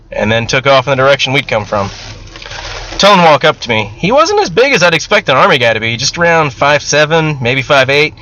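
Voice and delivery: ordinary delivery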